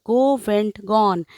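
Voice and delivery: ordinary talk, nothing marked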